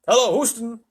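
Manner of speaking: loud, high radio hail; crying out. A narrator's reading. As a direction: call urgently into the mic. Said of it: "he cried into the mic".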